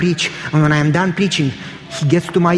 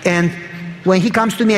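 normal talking voice

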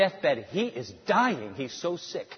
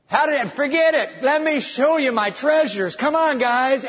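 higher, louder, brushing the question aside; eager showing off; 7 semitones higher, 5 dB louder than usual. Acted. King dodging the envoys' question to boast, eager and loud. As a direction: hurry them off to the treasure.

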